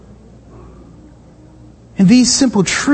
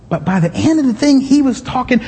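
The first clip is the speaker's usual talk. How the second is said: normal talking voice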